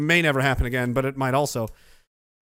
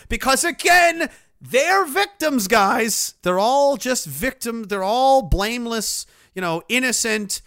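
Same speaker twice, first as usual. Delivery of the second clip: high, slow mocking emphasis; sarcastic indignation; 5 semitones higher, 4 dB louder, slower than usual. Streamer sarcastically calling his stalkers victims, mock indignation. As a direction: draw out each claim in sarcastic mock outrage.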